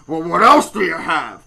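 loud, blubbery mock demand; hammed-up huffy bluster; rude customer. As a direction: mimic a jowly customer demanding options. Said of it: "he looks up and goes".

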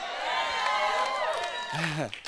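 high joyful cry; high ecstatic shout. Real, not acted. Preacher to congregation, a high ecstatic shout. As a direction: let out a high shout of praise.

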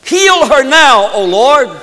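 loud, high, drawn-out cry to God; great cry. Acted. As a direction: cry out to the Lord at full voice. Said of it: "Moses cried out".

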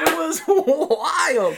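high loud laughing yell; laughing shout. Real, not acted. Laughing about battle.

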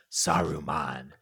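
low, quiet, grim Aragorn; a hint of grim ranger. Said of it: "grimly says".

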